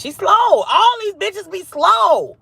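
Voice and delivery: high, drawn-out holler; loud, slow mocking outburst; exasperated shout. Real, not acted. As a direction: yell it high and stretch the last word. Mocking outburst about others being slow.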